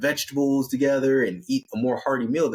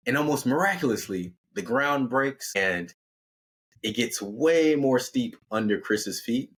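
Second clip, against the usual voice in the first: ordinary delivery, nothing marked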